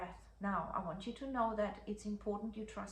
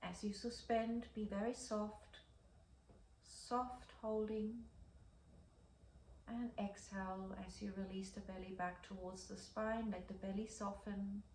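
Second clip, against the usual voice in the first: soft guided breathing; 6 dB quieter than usual. Acted. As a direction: ease them gently through the hold.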